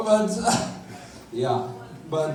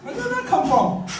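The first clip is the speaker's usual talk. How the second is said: high, outraged disbelief; pitched way up, mock indignant; incredulous. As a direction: squeal it out in shocked protest. Outraged retort to mother.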